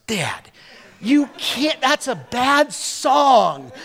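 high, slow mock protest from the girls; imitating daughters. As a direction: play the daughters scolding Dad, drawn out. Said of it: "This is about how it goes".

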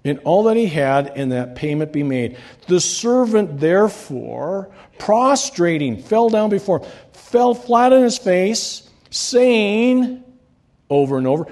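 lifted pulpit reading voice; scripture reading. Acted. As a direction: proclaim the parable to the church. Reading scripture aloud to congregation.

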